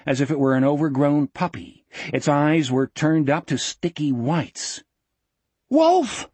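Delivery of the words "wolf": very high, urgent screamed name. A narrator's reading. Jack warning Wolf over the ripping sound, scream. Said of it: "Jack screamed".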